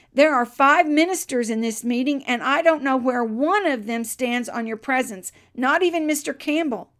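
bold prayer aloud. Retold or imitated. Blacksmith tells God about five ministers, bold loud prayer. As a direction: press the charge, loud and unsparing. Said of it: "He then went on to say".

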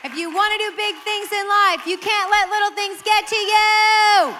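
high, loud, slow proclamation; full-force preacher repeat; preaching climax. Shown as a demonstration. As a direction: hammer it home to the crowd. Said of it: "repeated as climax".

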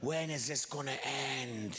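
low, groaning boredom; bored teenager. Retold or imitated. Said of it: "He was totally bored".

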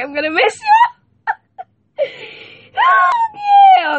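very high squeal breaking into laughter. Real, not acted. Host squealing goodbye to podcast listeners.

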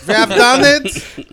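high, loud, drawn-out proclamation; slow, exultant mock German cry; triumphant mock German magician. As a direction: announce it like a hammy German magician's great triumph.

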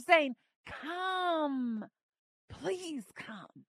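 normal talking voice

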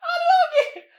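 "I don't get it" cried out high and loud in disbelief; exasperated outburst. Real, not acted. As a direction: throw the line up high and loud, baffled. Exasperated outburst after laughing.

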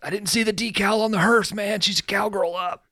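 high, strained mock lament; dying [name], mock. [name] voicing his own death, high mock wail.

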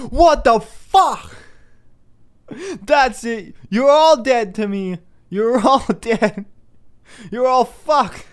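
high, loud hammed-up shout; mock raging God. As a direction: roar as a God who has lost it. God voiced raging at children.

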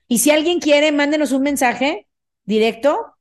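normal talking voice

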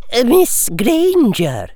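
high, slow, drawn-out address; professor's greeting. Acted. Professor greeting a tearful pupil in her office, high and slow. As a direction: stretch the name out in a high teacher's voice.

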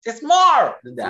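high, loud, drawn-out protest; mock-indignant parent. Parent correcting kid's lukewarm review, mock-indignant. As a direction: protest to your kid in playful outrage. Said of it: "I was like".

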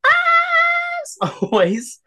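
drawn-out squeaky falsetto, very slow; very high squeak. Real, not acted. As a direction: stretch it into a tiny high squeak. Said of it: "attempt the as, as high pitched as possible".

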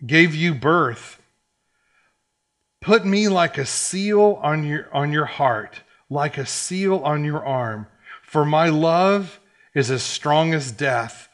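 loud, slow, emphatic Bible reading; scripture reading. Acted. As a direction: proclaim each phrase to the congregation, stretched out. Reads scripture aloud to congregation.

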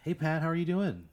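bright high greeting to a regular; cheery waitress. Retold or imitated. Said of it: "she's like".

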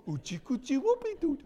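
put-on high, sing-song baby cooing; baby talk.

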